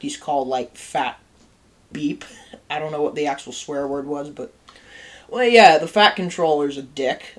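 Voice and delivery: ordinary talk, nothing marked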